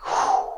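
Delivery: long, airy whoosh of breath; long exhale. Retold or imitated. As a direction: blow out a slow, uneasy breath. Floating away from his body.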